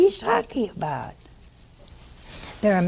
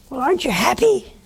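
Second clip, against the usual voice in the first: mock question; 7 semitones higher, faster than usual. Priest retells asking widow.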